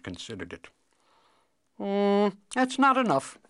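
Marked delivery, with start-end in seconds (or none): 1.8-3.4 high, louder, considering refusal; hum, then refuse in a high voice; objecting cobbler. Acted. Cobbler considers and rejects half the kingdom, objecting.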